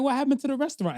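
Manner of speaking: high, baffled question; shocked, confused asking; surprised. Retold or imitated. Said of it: "I'm like".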